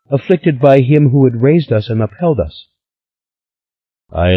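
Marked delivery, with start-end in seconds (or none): 0.1-2.7 put-on high, lively girlish voice; a lightly voiced excited young Stella; eager young woman. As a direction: spill the gossip breathlessly.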